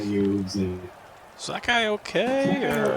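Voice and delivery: high-pitched bird asking aside; red bird's voice. Acted. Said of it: "He's like".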